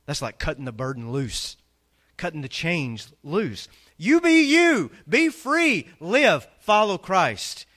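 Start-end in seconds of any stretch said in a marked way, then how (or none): 4.0-7.7 high, loud cry, slowing on the last words; climactic shout. Real, not acted. Pastor urging the congregation to be free, ringing shout. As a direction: lift them out of their seats.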